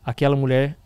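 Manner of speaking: ordinary delivery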